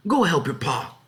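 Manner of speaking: high, firm motherly command; ordering. A narrator's reading. Mother ordering her sons to help their father, firm and high. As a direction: farm wife sending the boys out. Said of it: "Mistress Murdoch ordered".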